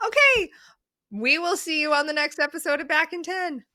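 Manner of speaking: high, fast, emotional words on the edge of tears; choked up, voice going up as she says goodbye; teary goodbye. Real, not acted. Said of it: "I'm gonna cry again".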